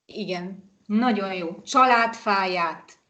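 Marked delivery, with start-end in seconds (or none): 1.6-3.0 "Családfáját" slowly, with long vowels; teacher's slow, measured "Családfáját"; slow model word. Shown as a demonstration. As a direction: model "Családfáját" carefully for learners.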